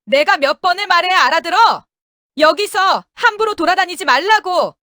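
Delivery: high-pitched, cutting reprimand from a woman boss; sharp scolding. Acted. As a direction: scold with a voice like a knife blade. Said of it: "목소리는 칼날처럼 날카로웠습니다".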